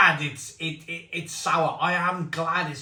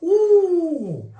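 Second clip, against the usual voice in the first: sour ooh; 13 semitones higher, 7 dB louder than usual. Real, not acted. Reaction to sour taste.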